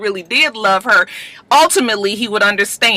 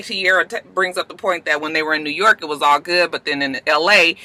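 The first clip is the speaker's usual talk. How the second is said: normal talking voice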